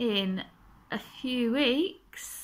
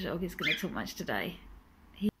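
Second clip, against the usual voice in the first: ordinary talk, nothing marked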